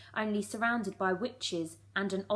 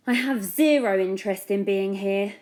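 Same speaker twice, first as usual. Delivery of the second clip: loud; 10 dB louder than usual. Acted. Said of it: "the witch said loudly".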